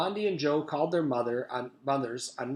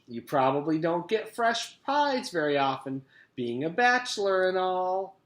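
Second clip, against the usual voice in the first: cafeteria lady voice; 5 semitones higher, 4 dB louder than usual. A narrator's reading. Miss Mush voice.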